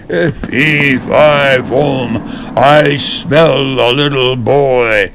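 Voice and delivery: slow, booming giant chant. Acted. Giant sniffing out Jack in the kitchen, booming.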